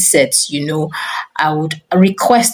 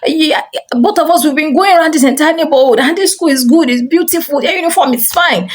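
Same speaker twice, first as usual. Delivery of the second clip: mock shouting at a child, exasperated; retold exasperated shouting; 9 semitones higher than usual. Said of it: "I just started shouting".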